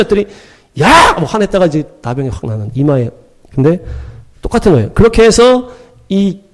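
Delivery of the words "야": sudden high-pitched yell; acted angry outburst. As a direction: imitate a king exploding at being told no. Retold king's angry outburst.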